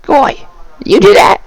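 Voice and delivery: high yelling goblin, calling out; a high goblin voice calling to someone. Acted. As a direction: shout it like a suspicious goblin.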